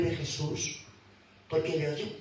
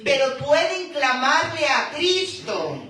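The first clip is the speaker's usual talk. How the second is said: loud, raised preaching voice; fervent preaching; 6 semitones higher, 5 dB louder than usual. Acted. Preacher urging the hard of hearing, loud fervent preaching. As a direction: press the call on the congregation.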